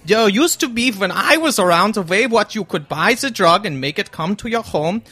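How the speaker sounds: mock German accent, comfortable in it; put-on German accent. Guest claiming his own accent, German accent. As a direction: play a hammy German character. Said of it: "talking in my own accent".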